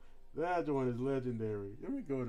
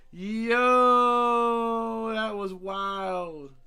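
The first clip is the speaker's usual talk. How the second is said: hyped; 6 semitones higher, 9 dB louder, much slower than usual. Real, not acted. Hyped over anime moment.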